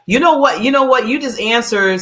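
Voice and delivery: bursting with excitement; excited. Real, not acted.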